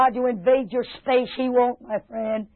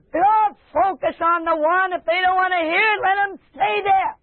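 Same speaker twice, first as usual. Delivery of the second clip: high, insistent preaching; forceful exhortation; 7 semitones higher than usual. Real, not acted. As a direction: drive the point home from the pulpit.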